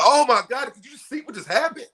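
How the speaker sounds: high, loud astonished outburst; shocked viewer yelling at a reality show; loud excited TV reaction. Retold or imitated. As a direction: gasp it out at the screen. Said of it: "I'm loud like that when I watch".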